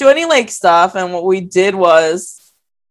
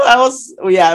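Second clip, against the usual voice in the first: usual speaking voice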